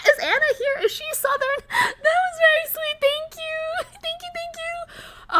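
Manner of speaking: high, helpless giggling; laughing. Real, not acted. Streamer thanking a fan after the accent reading, laughing. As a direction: talk through the laughter, voice climbing.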